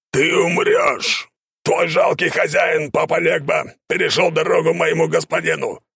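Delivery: raspy cawing crow. A narrator's reading. Crow demon threatens shaman.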